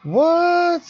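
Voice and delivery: high, loud disbelieving "What?"; shocked. Real, not acted. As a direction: blurt it out in shock. Shocked reaction.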